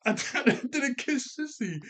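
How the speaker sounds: high mock yell broken by laughter. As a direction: imitate an upset yell while cracking up. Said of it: "we just hear Oric yelling".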